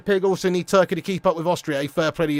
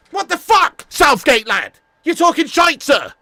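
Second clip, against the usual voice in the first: angry jeer; 7 semitones higher, 3 dB louder, slower than usual. Real, not acted. Shouting at Southgate.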